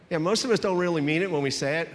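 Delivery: ordinary delivery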